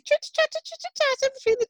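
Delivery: high, sing-song chitter-chatter; lightly hammed-up high prattle; mock chattering. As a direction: imitate two horses nattering away. Imitating two horses chattering away.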